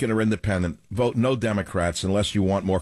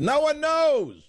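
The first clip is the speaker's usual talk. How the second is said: exasperated exclamation; slower than usual. Real, not acted. Host mocking contradictory polls to listeners, high exasperated cry. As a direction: voice leaps high on the punchline.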